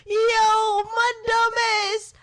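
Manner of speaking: high, loud words squeezed out through laughing; cry-laugh at your own dumb mistake; howling laughter. Real, not acted. Laughing at her own blunder, loud and high-pitched.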